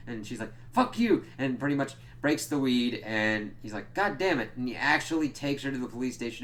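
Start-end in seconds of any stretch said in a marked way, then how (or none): 0.7-1.2 high, loud imitated outburst; angry retort. Daughter retorting to her dad, angrily. 3.9-4.5 higher-pitched imitated frustration; mock angry outburst. Dad finding his weed broken, mock angry.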